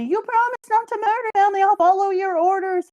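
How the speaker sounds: put-on very high, loud squeaky baby; a baby pleading with the villain. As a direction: squeak it like a tiny kid. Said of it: "says the baby".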